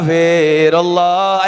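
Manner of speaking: slow, loud drawn istighfar; drawn-out call. Real, not acted. Leading the congregation in repentance, slow and loud. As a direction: stretch Astagfirullah out for all.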